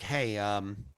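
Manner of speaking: softly voiced nurse saying sorry; soft nurse quote. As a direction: hush it like an apologetic nurse. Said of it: "she's like".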